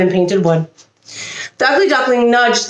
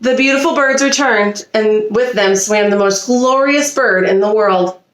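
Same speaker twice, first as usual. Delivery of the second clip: plain reading voice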